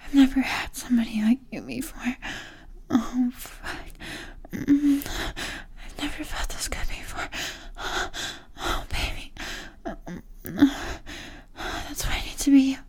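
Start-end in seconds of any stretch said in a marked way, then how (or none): none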